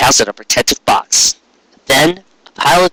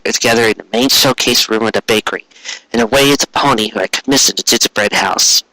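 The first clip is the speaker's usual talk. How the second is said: ordinary delivery, nothing marked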